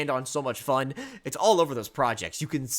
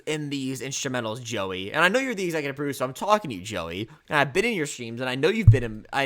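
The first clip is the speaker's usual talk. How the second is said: ordinary talk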